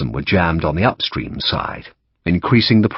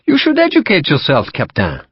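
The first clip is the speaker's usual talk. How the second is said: polite French officer, higher and louder; courteous French-accented captain; 8 semitones higher, 3 dB louder than usual. Acted. French captain to Sharp, polite French accent. Lacroix voiced.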